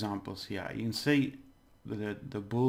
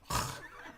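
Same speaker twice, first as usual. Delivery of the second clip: long rasped "khhh"; throat-scraping J sound; 4 dB quieter than usual. Shown as a demonstration. Teacher showing where J is made, throat rasp. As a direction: scrape air at the back of the mouth. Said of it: "the J has to be really".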